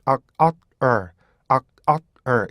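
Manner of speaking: "악, 악, er" as three clear beats. Shown as a demonstration. Teacher splitting "are" into three beats. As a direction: break "are" into three parts. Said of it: "세 개의 다른 발음이요".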